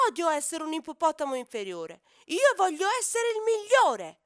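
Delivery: loud, high, rising angry wail; tantrum shout. Acted. Sulky hippo shouting his hatred of losing. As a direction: stamp your feet and yell.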